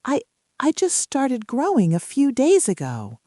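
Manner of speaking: high, halting little sprout; shy stammer of a tiny seedling; small shy voice. A narrator's reading. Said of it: "in this small, shy voice".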